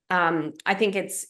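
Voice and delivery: ordinary delivery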